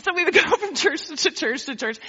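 laughing through the list. Real, not acted. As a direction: chuckle as you say it. Laughing retelling.

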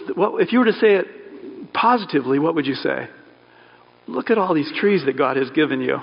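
ordinary talk, nothing marked

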